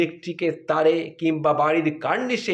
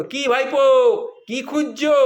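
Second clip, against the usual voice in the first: higher, louder call across the yard; 6 semitones higher, 4 dB louder than usual. Retold or imitated. Priest uncle calls to nephew from afar, raised voice.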